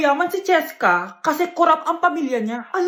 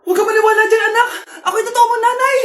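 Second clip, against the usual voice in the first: put-on loud, high, crying plea to the child; tearful pleading shout; 6 semitones higher, 6 dB louder, faster than usual. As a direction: beg the child through tears, loudly. Two mothers fighting over child. (umiiyak na pagsigaw ng ina)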